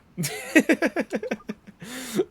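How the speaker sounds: high laugh. Acted. Character laughs after Mister Drippy line.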